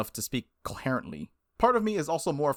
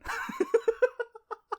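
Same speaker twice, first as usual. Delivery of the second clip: high burst of laughter. Real, not acted. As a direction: let a real laugh burst out. Laughing at his own joke.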